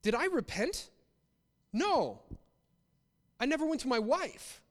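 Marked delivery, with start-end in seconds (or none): none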